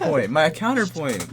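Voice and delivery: insistent. Real, not acted. Debater talking over friend to make a counterpoint, insistent. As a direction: push the point over an interruption.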